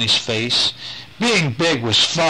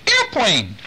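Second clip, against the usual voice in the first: put-on baby voice; 10 semitones higher than usual. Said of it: "said Adam".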